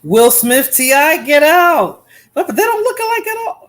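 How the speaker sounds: loud mocking disbelief; incredulous. Real, not acted. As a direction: shout your disbelief at the lookalikes. Scoffs at Irby's celebrity picks.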